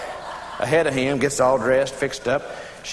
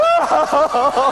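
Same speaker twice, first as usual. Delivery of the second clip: high, long wavering mock wail; horrified wail; 8 semitones higher, 4 dB louder than usual. Wife learning it was the neighbor, wailing in horror. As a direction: wail it out in pulses, hammed up. Wife realizes her awful mistake.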